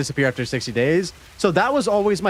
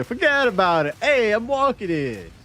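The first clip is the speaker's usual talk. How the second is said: Italian New Yorker; 6 semitones higher than usual. Pretend. Streamer blocked by molten ground, in a mock New York Italian accent. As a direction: play a New York cabbie yelling at traffic. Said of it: "turns me into a completely fucking Italian New Yorker".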